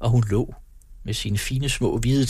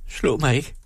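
a touch higher, mildly fearful plea; frightened cry; 5 semitones higher than usual. A narrator's reading. Louisa ducking from Macario, mild fearful plea. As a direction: cower and beg not to be hit, understated. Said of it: "udbrød hun og dukkede sig".

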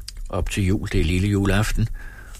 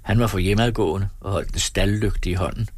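usual speaking voice

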